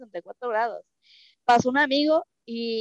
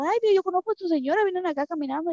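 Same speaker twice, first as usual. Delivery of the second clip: lightly voiced friend, pitched higher; friend's surprised call; 7 semitones higher than usual. Husband's friend spotting them walking in the snow, higher surprised voice. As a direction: call out higher, like spotting someone you know. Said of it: "nos dijo".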